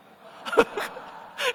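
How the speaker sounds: high breathy laugh bursting out; squealing laugh; burst of laughter. Real, not acted. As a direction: laugh out loud at the money trick. Laughing mid-story about money.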